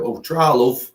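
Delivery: high, loud put-on northern English accent; best northern accent. Childish grandson leaving grandma's house, mock northern accent. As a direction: call it out in a hammed-up northern accent. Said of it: "in that's my best northern accent".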